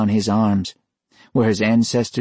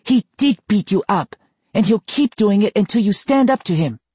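put-on high, louder scolding girl; scolding sister; 13 semitones higher, 3 dB louder than usual. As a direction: tell him off firmly.

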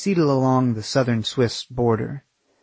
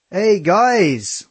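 a high, louder call of "Hey, guys"; 8 semitones higher, 4 dB louder than usual. Acted. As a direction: shout a greeting across to them. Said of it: "one of them called out".

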